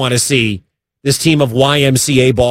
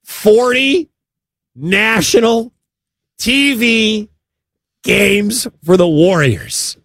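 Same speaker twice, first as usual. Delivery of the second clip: high, drawn-out disbelief, pausing between words; incredulous; 7 semitones higher, much slower than usual. Real, not acted. Host reacting to an agent's demand for TV games, incredulous. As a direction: marvel at it word by word, pitched way up.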